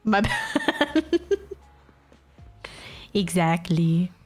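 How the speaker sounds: usual speaking voice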